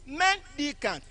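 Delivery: "Mendicant" with MEN high; careful demonstration of "Mendicant", weight on MEN; first-syllable stress. Shown as a demonstration. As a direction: start "Mendicant" high and step down. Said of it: "It has a different stress pattern".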